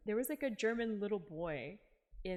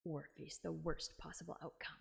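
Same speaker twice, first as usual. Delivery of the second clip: lower, lightly voiced interrogator; stern questioner; 4 semitones lower than usual. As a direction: lightly threaten a witness, pitched down. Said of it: "this guy's just still doing the same thing".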